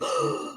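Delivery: sharp high gasp of shock. Retold or imitated. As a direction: suck in air in shock.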